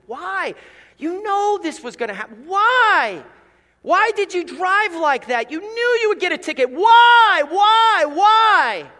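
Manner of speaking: building from pleading to high, loud shouting; anguished parent. Acted. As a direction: cry out to a child who keeps relapsing. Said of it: "grieving parent cries out".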